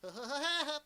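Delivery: a rough attempt at the Woody Woodpecker laugh. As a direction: try the cartoon woodpecker's trademark laugh. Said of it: "Let me see if I can do Woody the Woodpecker".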